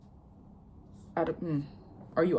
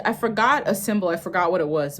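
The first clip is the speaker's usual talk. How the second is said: ordinary talk, nothing marked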